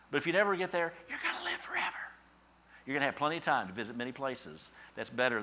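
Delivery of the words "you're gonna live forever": whisper. Acted. Hushed promise of eternity.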